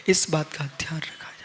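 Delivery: whispered reminder, breath only; hushed whisper. Real, not acted. Monk whispers his final advice to the departing listener. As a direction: drop to a whisper to seal the point.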